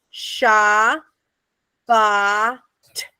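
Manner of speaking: Sha, Ba, T, each piece spaced apart; splitting syllables. Shown as a demonstration. Teacher sounding out Shabbat piece by piece. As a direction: break the word into separate pieces.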